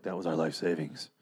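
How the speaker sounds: low, soft imitation of a different character; imitating last season's John. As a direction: drop low and hushed to mock the voiceover. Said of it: "He didn't sound like that. That was, like, John from last season".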